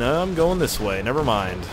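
ordinary delivery

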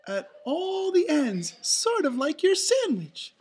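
high, very loud cartoon mouse; Minnie explaining. A narrator's reading. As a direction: explain emphatically in falsetto. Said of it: "Minnie explained".

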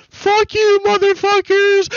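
high, loud mock yelling at the crowd. Mimicking Zach taunting police, high mock yell. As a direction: shout it up top like a taunting rioter. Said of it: "being like".

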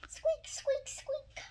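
tiny, very high squeaking voice; baby bird squeak. Acted. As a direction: squeak like a chick inside its shell. Baby squeaking inside the egg.

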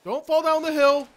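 high, loud alarmed shout at the screen; panicked yell. Real, not acted. Gamer yelling at the screen in fright. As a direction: yell in real panic for her not to fall. Panicking at game character falling.

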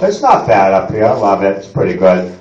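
low, quiet resigned local; low, resigned Mainer. Retold or imitated. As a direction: drone it like a Mainer consoling himself. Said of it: "This is what we say".

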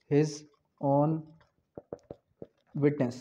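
long pause after every word; word-by-word dictation. Shown as a demonstration. Teacher dictating the article to students, word by word. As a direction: give each word alone for writing down.

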